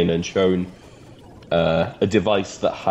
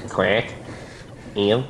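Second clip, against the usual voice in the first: Scouse duck accent. Shown as a demonstration. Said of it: "a Scouser that's like".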